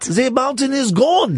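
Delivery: high, slow mock-tragic Swiss accent; hammed-up Swiss wailing. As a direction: lament in a silly Swiss accent. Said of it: "It's a Swiss accent".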